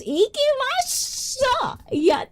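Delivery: high, drawn-out squeal of delight; excited cheer. Real, not acted. Cheering about the Tokyo tour.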